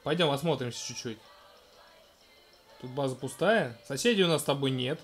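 ordinary delivery

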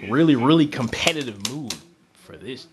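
ordinary talk, nothing marked

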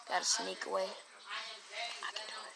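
hushed, sneaking under the breath; sneaky hush. Pretend. Kid sneaking his squirrel away from danger, hushed. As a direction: sneak the words out barely voiced.

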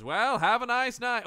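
high, chummy sign-off; lightly voiced friendly hunter; hint of a folksy goodbye; mock hunter. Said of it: "he just goes".

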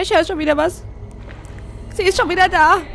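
high, loud frightened cry; alarmed. Real, not acted. Player spotting the monster again, high frightened cry. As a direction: blurt it out in fright.

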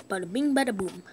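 ordinary talk

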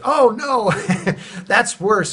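amused, laughing correction; laughing reply. Retold or imitated. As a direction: laugh it out as if it's obvious. Retelling tree company man's reply.

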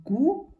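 Гу sung out high and loud, split off from дзик; syllable split. Shown as a demonstration. Teacher splits Гу off for the pupils, loud and high. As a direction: break гудзик after Гу, hitting Гу high. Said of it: "Слово гудзик".

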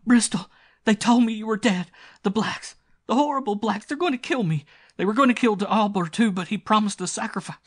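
high, loud panic-stricken woman's cries; hysterical screaming. A narrator's reading. Rescued girl clinging to her rescuer, hysterical screaming. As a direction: blurt it out in hysterical terror. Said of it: "Then she screamed, clung to him, sobbing hysterically".

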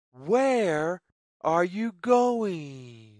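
usual speaking voice

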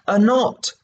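high, eager exclamation; eager Alice. Acted. Alice offering to untie a knot, eager. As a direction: jump in brightly to help.

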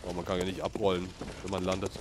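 ordinary talk, nothing marked

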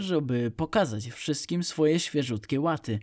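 usual speaking voice

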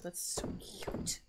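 slow, breathy whisper of delight. Real, not acted. Streamer whispering over handmade clothes, slow.

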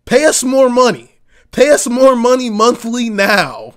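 loud, high, greedy demand; mock insurer demand.

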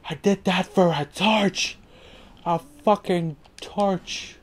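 high, drawn-out incredulous complaint; exasperated disbelief. Real, not acted. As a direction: stretch each word in disbelief.

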